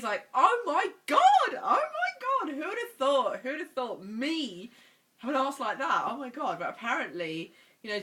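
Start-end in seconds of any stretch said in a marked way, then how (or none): 0.3-6.6 high, loud giddy disbelief; excited squeal. Real, not acted. Vlogger gushing to viewers about her genetics, excited squeal. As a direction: squeal it like you can't believe your luck. Excited reaction to booty news.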